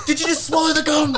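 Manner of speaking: hammed-up drill-sergeant shout; retold yelling. Sergeant on the bus yelling at a recruit over gum, mock shout. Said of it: "just yelling at me".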